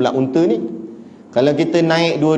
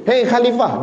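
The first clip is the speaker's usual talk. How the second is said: sharp higher call of the angry woman; 7 semitones higher than usual. Retold or imitated. Angry woman calling out to the caliph, sharp and raised. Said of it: "dia marah-marah orang atas tu".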